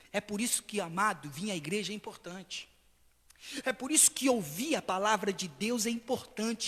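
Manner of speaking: usual speaking voice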